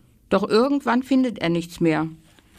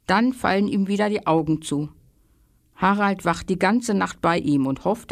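ordinary delivery, nothing marked

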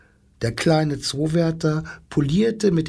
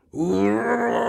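high hammed-up lion howl; lion's cry; 9 semitones higher than usual. Lion cries out at hearing of the desert, high howl. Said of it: "schrie der Löwe".

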